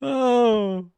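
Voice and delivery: sudden high startled oh. Real, not acted. Startled by a noise on the trail, a high sudden oh. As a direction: jump at a noise.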